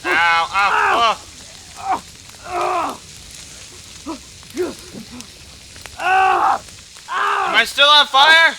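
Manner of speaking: loud, high cries of pain; panicked yelping. Real, not acted. Player realising they are on fire, panicked yelping. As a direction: yelp and cry out in alarm. Burning in the game.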